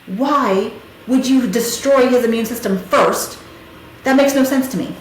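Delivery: indignant challenge. Retold or imitated. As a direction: challenge the doctor's logic, stressing key words. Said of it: "I was so pissed".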